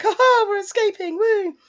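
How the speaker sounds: high, loud mock triumph; gleeful cheer. Two boys jumping the balcony, imitated glee. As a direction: whoop it up like playful runaways. Acting boys' gleeful escape.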